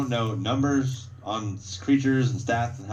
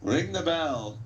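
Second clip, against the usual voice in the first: high, carrying captain's command; raised-pitch announcer-like order; guard captain; 9 semitones higher, slower than usual. Acted. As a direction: call it out high to start the fight. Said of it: "Captain says".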